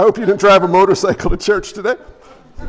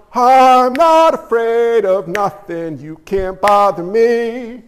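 slow, drawn-out fearless boast; mock bravado; slower than usual. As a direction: parody someone claiming to fear nothing. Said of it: "This is not David going".

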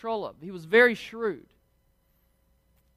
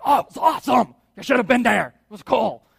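put-on excited, louder New York accent; 3 semitones higher, 5 dB louder, faster than usual. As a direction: play an excited shepherd as a New Yorker. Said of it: "they have a New York accent".

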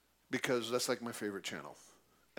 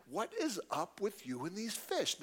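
put-on buddy's blunt Chicago accent, a touch higher; Chicago version; 5 semitones higher than usual. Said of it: "That's the Chicago version".

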